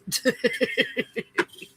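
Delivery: breathy run of giggles; amused chuckle; giggling laugh. Real, not acted. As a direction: laugh lightly at yourself. Laughs at own remark.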